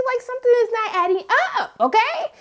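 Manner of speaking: fast, high, exasperated call-out; exasperated sass. Retold or imitated. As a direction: rattle it off like you've caught him lying. Confronting Tony about messages.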